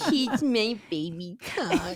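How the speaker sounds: slow, put-on baby voice; baby talk. Shown as a demonstration. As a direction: introduce it in drawn-out baby talk. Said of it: "Everyone introduce your baby talk".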